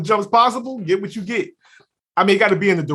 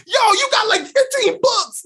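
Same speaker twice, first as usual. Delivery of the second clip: much higher, outraged disbelief; shocked outburst. Retold or imitated. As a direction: yell it like you've been robbed. Shocked spades partner.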